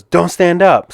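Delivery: high, loud urgent order; ref yelling. Retold or imitated. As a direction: yell it to keep him down. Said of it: "kinda yelling at me".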